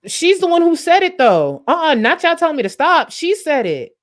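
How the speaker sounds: high, loud laughing protest. Real, not acted. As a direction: laugh it off and insist loudly. Defending herself laughing, excited.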